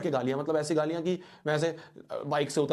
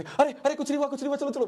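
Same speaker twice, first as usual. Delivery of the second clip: much higher, hurried hushing grandpa; flustered grandfather; 11 semitones higher than usual. Retold or imitated. Grandfather hushing the screaming boy in front of shops, flustered. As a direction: hush the scene quickly, embarrassed.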